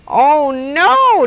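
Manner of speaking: high, loud hoot of dismay; rising, whooping cry. A narrator's reading. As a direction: hoot it out at a friend's blunder. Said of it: "Desmond hooted".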